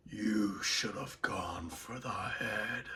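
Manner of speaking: slow, soft, breathless threat; unvoiced villain whisper; Thanos whisper. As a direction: whisper the killing line. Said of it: "We're gonna do Thanos".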